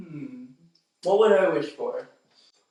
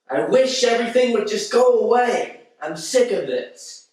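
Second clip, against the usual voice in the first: high, loud, hammed-up complaint; exasperated wisher; 8 semitones higher, 4 dB louder than usual. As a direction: whine loudly like a fed-up innocent. Plays exasperated mortal in impression skit.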